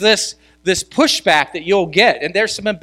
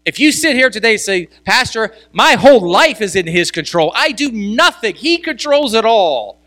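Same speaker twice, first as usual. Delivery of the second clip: loud, higher preaching build; preaching at full force; 6 semitones higher, 5 dB louder than usual. Acted. Pastor urging the congregation, preaching at full force. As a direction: lift the congregation, louder and higher.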